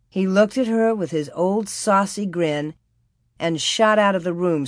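usual speaking voice

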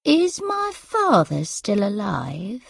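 put-on slow, tired old mother; tired elderly mother. A narrator's reading. Mother in armchair asks daughter about her father, tired. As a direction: ask slowly as a weary, confused mother. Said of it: "came the tired voice from the armchair".

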